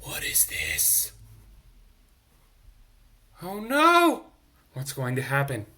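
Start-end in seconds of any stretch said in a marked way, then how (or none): 0.0-1.1 low, slow whispered suspense. Real, not acted. Reader whispering suspense to the listening children. 3.4-4.3 high, loud cry of alarm. Real, not acted. Reader crying out as the owl appears.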